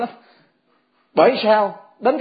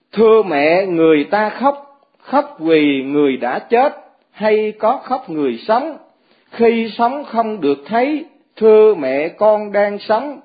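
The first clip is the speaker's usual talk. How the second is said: slow, measured, louder recital of a verse, each phrase drawn out; slow verse recitation; 4 dB louder, slower than usual. Acted. Monk recites his verse to his weeping mother, slow and solemn. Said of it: "mới đọc lên một bài kệ như thế này".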